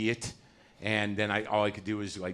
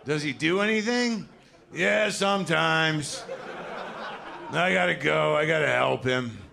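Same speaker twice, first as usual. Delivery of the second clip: put-on embarrassed kid; 7 semitones higher, 4 dB louder, slower than usual. Kid with old dad answering.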